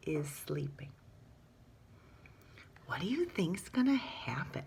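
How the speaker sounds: hushed whisper building suspense. A narrator's reading. Teacher asks the class, suspenseful whisper. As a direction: whisper it like a secret.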